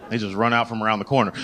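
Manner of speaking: ordinary talk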